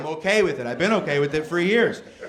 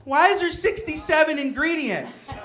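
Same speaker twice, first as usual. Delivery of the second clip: mock wife voice; 9 semitones higher than usual. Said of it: "she's like".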